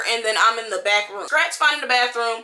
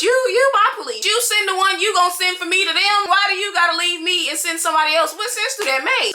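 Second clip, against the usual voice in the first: higher, exasperated scolding; indignant complaint; 6 semitones higher than usual. Retold or imitated. To the officer who left her, high indignant scolding. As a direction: demand to know why he left.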